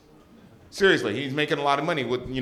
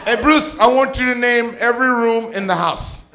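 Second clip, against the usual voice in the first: much higher, loud, excited mom shouting after him; eager mom calling; 11 semitones higher, 8 dB louder than usual. Retold or imitated. Mom calling her son back with another joke, high and loud. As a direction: call him back like you can't wait. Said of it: "She goes".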